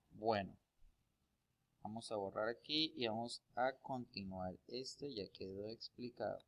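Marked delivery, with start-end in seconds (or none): none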